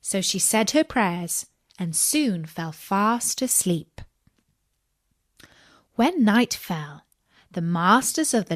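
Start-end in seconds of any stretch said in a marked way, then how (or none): none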